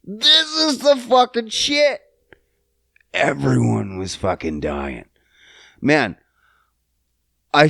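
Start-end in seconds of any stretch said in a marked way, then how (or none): 0.0-2.0 high-pitched hyped exclamation; awestruck outburst. Retold or imitated. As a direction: blurt it out in overblown excitement. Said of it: "that I was like".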